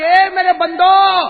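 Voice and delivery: high, slow, loud call; booming divine call. Acted. God calling his servants on Judgement Day, booming. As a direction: call out as if from the heavens. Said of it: "اللہ کا قیامت میں آواز آئے گی".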